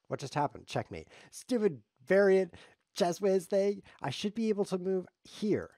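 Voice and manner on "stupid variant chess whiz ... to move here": loud, high-pitched frustration; indignant, raised-voice griping; exasperated complaint. Real, not acted. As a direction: protest loudly at an unfair loss. Frustrated complaint after being checkmated.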